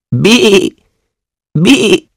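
high, bleating goat cry. Acted. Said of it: "said the goat".

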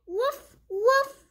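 high little woof woof of a puppy; puppy bark. Acted. The puppy Biscuit barking back, high happy woofs. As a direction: yip it like a happy small dog.

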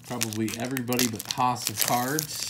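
usual speaking voice

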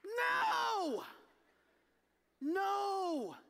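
emphatic no. Real, not acted. Rejects own silly portrayal.

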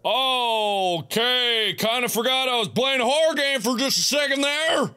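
high-pitched, rattled outburst; rattled after scare. Real, not acted. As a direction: draw out the first word, then rush on in alarm. Recovering from a jump scare.

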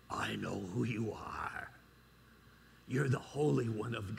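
low growling demon voice, deep and menacing. Acted. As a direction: play the possessed man's demon, low and dark. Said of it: "this is my best demon voice".

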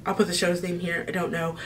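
ordinary talk

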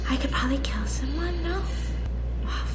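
hushed secret whisper. Real, not acted. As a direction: whisper so nobody hears. Imposter whispering in dark.

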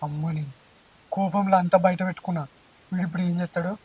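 anxious inner aside, very soft; worried aside. Acted. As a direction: murmur the panic privately. Panicked aside.

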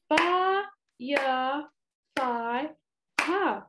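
"Fire, fire" broken into four beats, long gaps between; split into syllables. Shown as a demonstration. Teacher clapping out firefighter for children, "Fire, fire" split syllable by syllable. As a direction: sound out "Fire, fire" as fi, re, figh, ter, one clap each. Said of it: "let's split these words with me".

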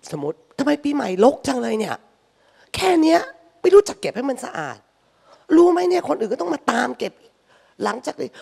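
high, loud scolding nag; nagging scold. Retold or imitated. Mother nags family about New Year mess, high loud scolding.

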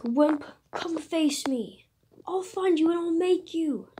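hammed-up villain threat; taunting comic villain. Villain taunts escaping hero.

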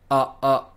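two short separate "あ" sounds as uh, uh; "あ" as the vowel in English cup. Shown as a demonstration. Teacher isolates the vowel of cup, short uh. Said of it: "この音".

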